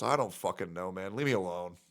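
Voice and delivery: deep, soft put-on grumble; mock deep grumble. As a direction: growl it like a sulky bear. Answering about his voice.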